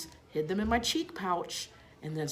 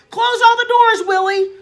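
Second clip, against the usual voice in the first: much higher and louder urgent command; shouted order; 17 dB louder than usual. A narrator's reading. Father shouts to his son to shut the doors. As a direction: yell the order across the house.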